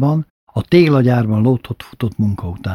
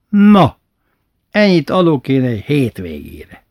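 primás topping the state's salary; boastful offer; 3 semitones higher, 4 dB louder than usual. Retold or imitated. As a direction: brag about paying more. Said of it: "primás makes an offer".

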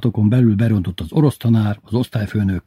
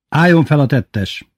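slow, higher-pitched command; firm order; 6 semitones higher, 4 dB louder than usual. A narrator's reading. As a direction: order it with resolve. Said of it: "mondta határozottan". (határozott, lassú felszólítás)

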